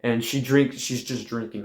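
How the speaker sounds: ordinary talk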